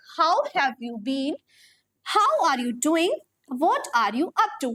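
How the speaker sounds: each word spaced out, slow and clear; slow model sentences. Shown as a demonstration. Teacher modelling phrases for students, slow and clear. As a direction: read it slowly so students can copy. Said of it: "teacher models sentences slowly".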